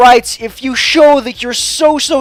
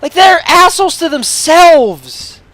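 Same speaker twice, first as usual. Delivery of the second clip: raised, high, exasperated shouting; real heated outburst, pitched way up; angry rant; 9 semitones higher, 5 dB louder than usual. Real, not acted. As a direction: rant loudly, dragging out the last words. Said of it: "I'm sorry for that rant".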